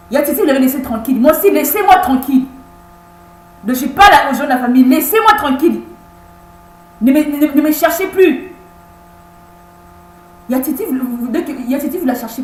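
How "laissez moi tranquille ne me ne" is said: ordinary talk, nothing marked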